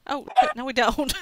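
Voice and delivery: loud, dismayed shout of realization. Real, not acted. Player realizing the smeltery isn't finished, dismayed shout. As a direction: shout it as the build fails.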